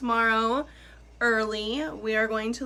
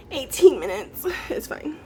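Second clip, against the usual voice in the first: ordinary delivery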